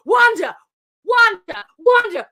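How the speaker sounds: loud voice calling over the radio. Retold or imitated. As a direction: call the name out, each time alike.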